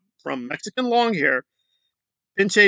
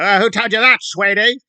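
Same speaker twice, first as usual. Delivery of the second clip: condescending "sweetie," a bit louder; mock snippy comeback; 4 semitones higher, 5 dB louder than usual. Mocking an angry protester's fake-sweet retort. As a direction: sneer it out like a patronizing scold. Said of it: "got angry".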